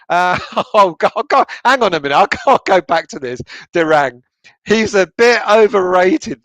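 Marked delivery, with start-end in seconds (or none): 4.6-6.4 reading the comment slowly, giggling and louder; struggling through it with laughter; rereading a viewer's cheeky comment, laughing. Real, not acted. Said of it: "it made me laugh".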